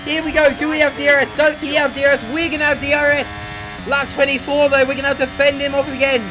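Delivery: fast-flowing, urgent racing commentary; live play-by-play. Real, not acted. Gamer calling his own final-lap battle to viewers, excited play-by-play.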